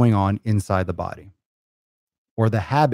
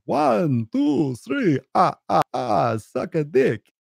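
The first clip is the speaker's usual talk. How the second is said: high, slow, put-on counting vampire voice; Sesame Street Count; 7 semitones higher, much slower than usual. Mocking childhood counting lessons, as the Sesame Street Count. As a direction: ham up the puppet count, dragging the ah ah ah. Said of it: "That was a count for any of you Sesame Street fans".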